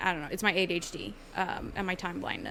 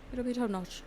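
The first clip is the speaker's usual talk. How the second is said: mock Irish accent; 4 semitones higher, 3 dB quieter than usual. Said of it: "no accents this week".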